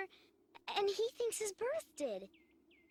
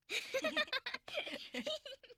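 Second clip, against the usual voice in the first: high giggling laugh; laughter; 7 semitones higher than usual. Acted. Girl laughs, heard.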